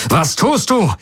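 high, agitated demand; upset question. A narrator's reading. Jun confronts Galen about the attack, agitated and high. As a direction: challenge him, alarmed and upset. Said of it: "fragte Jun aufgebracht".

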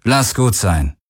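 tired, resigned delivery; weary. A narrator's reading. Captain telling the pilot to give up, weary. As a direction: let it go wearily. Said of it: "sagte Akina matt".

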